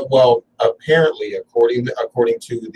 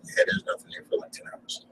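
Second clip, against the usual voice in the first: hushed; 13 dB quieter than usual. Retold or imitated. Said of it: "It's like".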